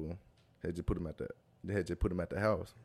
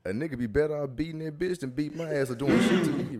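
loud, high, half-laughing outburst; heated protest; 6 semitones higher, 9 dB louder than usual. Real, not acted. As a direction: refuse loudly, laughter breaking in. Protests dying over cool.

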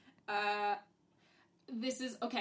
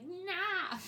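higher-pitched retold yell of protest; mock scream; 10 semitones higher than usual. Five-year-old protesting at a confusing movie, mild mock scream. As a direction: imitate a small child yelling at the screen. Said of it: "Screaming at the T V".

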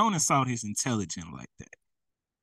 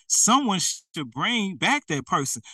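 ordinary delivery

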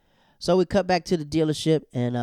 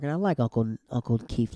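usual speaking voice

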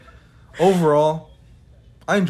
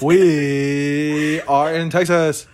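slow, drawn-out put-on voice; weird drawn-out voice; 2 semitones higher, 5 dB louder, much slower than usual. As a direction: drag out every word strangely. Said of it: "That sounded so weird".

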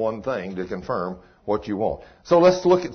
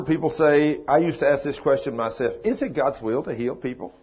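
ordinary talk, nothing marked

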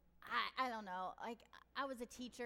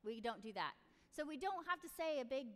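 normal talking voice

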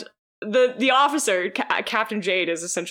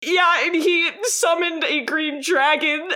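rising laughing babble; 8 semitones higher than usual. Retold or imitated. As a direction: pile on the lies, cracking up more each line. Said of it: "And he's like".

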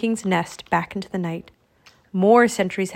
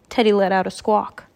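usual speaking voice

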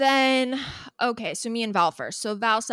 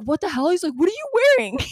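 high, loud, laughing mock shock; retold disbelief; 10 semitones higher, 6 dB louder, faster than usual. Friend reacting to her outfit, retold in laughing disbelief. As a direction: exclaim it like a stunned friend.

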